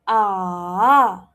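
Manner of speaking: AH dipping low, then rising, loud; long AH in a V shape; third tone. Shown as a demonstration. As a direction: drop AH and bring it back up. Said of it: "it drops and then it rises".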